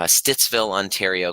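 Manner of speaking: ordinary talk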